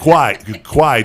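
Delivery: "Cry" said as a lilting "kwai"; patois lilt. Retold or imitated. Host savors the parent's patois, "Cry" drawn out as "kwai". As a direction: hit "Cry" loudly with a w glide, "kwai". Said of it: "the patois has got the, the, the lilt".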